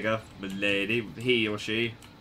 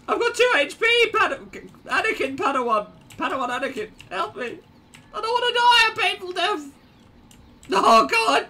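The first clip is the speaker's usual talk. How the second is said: very high, squealing plea for help; hammed-up falsetto panic, rushing; mock panic; faster than usual. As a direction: whimper and squeal in hammed-up terror. Nearly dead, begging teammate for help.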